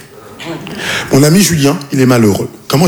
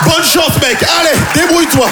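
mock shout; 11 semitones higher than usual. Dismissing the friend loudly.

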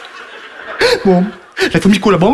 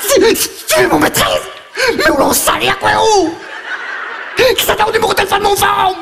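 much higher jealous wife voice; shrill jealous wife; 10 semitones higher than usual. Acted. Jealous wife on the phone, voiced much higher. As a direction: play the outraged wife demanding the number.

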